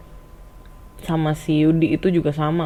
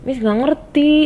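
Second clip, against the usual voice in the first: bingung; 9 semitones higher than usual. Retold or imitated. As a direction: press the pupil, bewildered. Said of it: "Gue tambah bingung dong". (bertanya bingung dengan nada tinggi)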